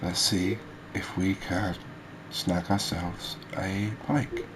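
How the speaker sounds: ordinary talk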